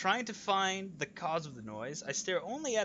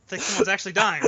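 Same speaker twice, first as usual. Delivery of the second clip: excited; 2 semitones higher, 11 dB louder, faster than usual. Real, not acted. Excited joke reply.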